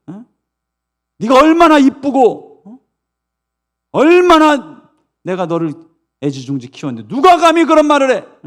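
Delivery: loud, high protective outrage; acted indignant mother, stretching key words; angry mother. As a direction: flare up in defence of your child. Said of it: "이러면서 막 화를 내죠, 엄마가".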